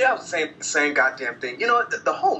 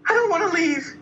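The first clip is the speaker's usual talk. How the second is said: mocking girlfriend; 13 semitones higher than usual. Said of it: "she was like".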